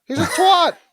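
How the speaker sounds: squeaky mocking insult; high mocking voice. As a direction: pitch way up to mock him.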